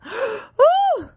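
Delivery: high squeak of shock; a sudden, high, offended yelp; mock shocked gasp. Lady poked with a pin, in a mock shocked squeak. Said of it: "Her face was like".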